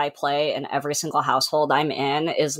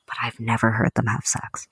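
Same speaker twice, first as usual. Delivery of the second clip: right in your ear; 6 semitones lower than usual. Real, not acted. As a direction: close to the mic, whispering. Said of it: "I will get close to the mic and whisper this".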